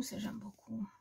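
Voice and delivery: soft breathy aside of delight; whisper. Real, not acted. Showing a paper pad to viewers, in a soft whisper. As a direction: whisper it like a little secret.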